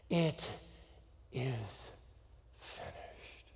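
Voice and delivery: a soft whisper trailing into silence; dying whisper, fading to nothing; whispered last words. Acted. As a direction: breathe it out as life ends. Final words quoted in a hush.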